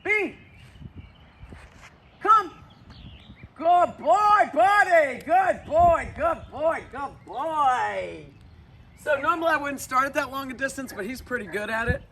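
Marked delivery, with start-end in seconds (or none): 0.0-8.2 high, loud cheering call to the dog, fading to plain praise; enthusiastic recall call. Real, not acted. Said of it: "it was an enthusiastic tone".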